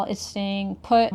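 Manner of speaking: ordinary delivery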